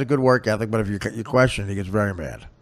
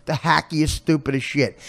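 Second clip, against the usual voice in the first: usual speaking voice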